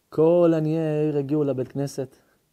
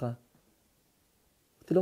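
plain reading voice

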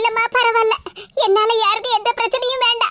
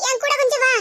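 ordinary talk

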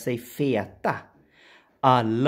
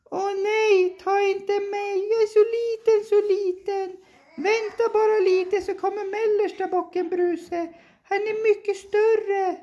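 small goat pleading. Acted.

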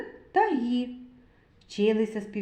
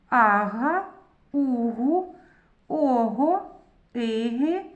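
syllable drill; much slower than usual. Shown as a demonstration. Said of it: "Прочитайте склади".

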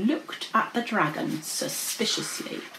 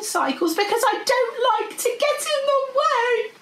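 higher-pitched, lilting dragon character; flustered dragon; 10 semitones higher than usual. Acted. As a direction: explain yourself, fussy and defensive.

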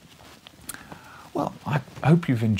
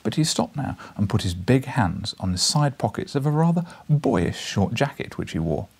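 plain reading voice

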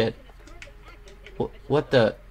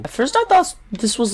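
ordinary delivery

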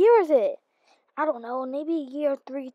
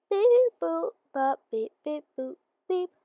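robot beeping; 4 semitones higher than usual. Acted. Robot noises.

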